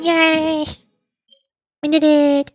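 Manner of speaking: ordinary delivery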